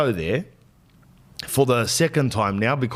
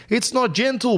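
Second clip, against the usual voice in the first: mock outrage; 11 semitones higher than usual. Complaining about rough massage.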